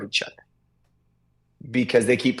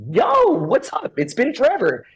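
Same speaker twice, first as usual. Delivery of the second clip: mock excited hello; 9 semitones higher than usual. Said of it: "and they're like".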